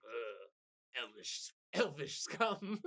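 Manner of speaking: a light, slow, sneering dwarf voice; lightly voiced grumpy dwarf sneer; disgusted dwarf. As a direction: spit out the insult with mild contempt. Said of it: "he's like".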